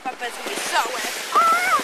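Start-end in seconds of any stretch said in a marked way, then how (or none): 1.3-1.8 loud high-pitched scream. Real, not acted. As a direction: scream as you crash. Crash while sledding.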